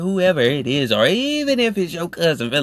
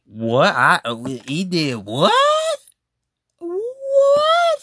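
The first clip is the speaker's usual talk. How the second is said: disbelief; 5 semitones higher, much slower than usual. Real, not acted. Disbelief on hearing the story.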